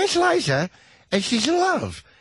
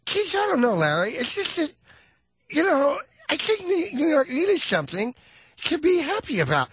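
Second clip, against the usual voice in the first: high, lisping [name] voice; 9 semitones higher than usual. Retold or imitated. Host mimics Liza answering Larry King with a lateral lisp. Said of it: "she's got a lateral lisp".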